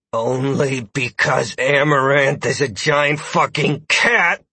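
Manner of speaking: slow seething through teeth. Acted. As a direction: hiss it through gritted teeth. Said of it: "seethed through his teeth".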